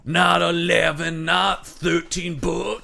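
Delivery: slow, booming trailer voice; dramatic movie trailer read; trailer announcer. Retold or imitated.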